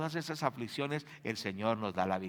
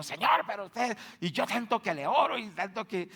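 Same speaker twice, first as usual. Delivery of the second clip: higher, put-on indignant voice; mock angry complaint; 7 semitones higher, 3 dB louder than usual. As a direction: act out an annoyed sister scolding God. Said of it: "la hermana salió brava". (queja enojada imitada, más aguda)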